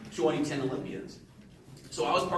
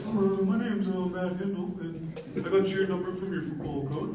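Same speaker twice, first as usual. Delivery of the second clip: lightly put-on Lethbridge guy, a bit higher; funny Lethbridge guy voice; 4 semitones higher than usual. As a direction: imitate the recruiter's funny voice, lightly done. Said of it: "guys from Lethbridge talk, like, he's like".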